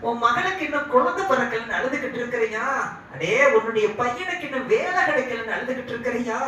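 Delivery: high-pitched, insistent questioning; solicitous angel. Retold or imitated. Angel to weeping parents about their worries, high and solicitous.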